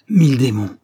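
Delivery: loud, angry outburst; furious oath. A narrator's reading. Lieutenant enraged after being struck, furious oath. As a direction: spit the curse out in rage. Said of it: "ivre de colère".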